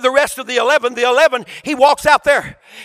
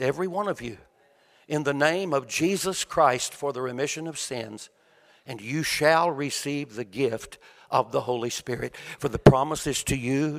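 soft, low, calm plea; Peter's calm answer to the crowd, quiet and low; calmly; 7 semitones lower, 8 dB quieter than usual. Acted. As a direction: gently lay out the call to repent, lower and softer. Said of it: "very calmly, simply says".

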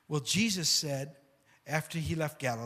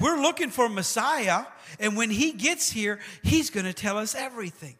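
excited woman's voice; 6 semitones higher, 5 dB louder than usual. Acted. Woman voiced in retold story.

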